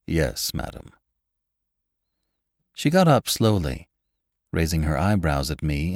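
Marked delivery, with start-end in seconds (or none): 0.1-0.9 low, soft butler's reply. A narrator's reading. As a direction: confirm discreetly as a butler. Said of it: "Gatsby's butler".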